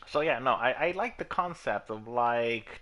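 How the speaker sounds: ordinary talk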